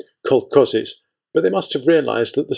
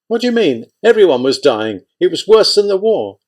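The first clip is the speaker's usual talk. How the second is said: higher, lightly voiced old village woman; a kindly old aunt recounting the occupation, voice raised; old aunt Christia; 6 semitones higher, 4 dB louder than usual. A narrator's reading. Christia answers about the famine.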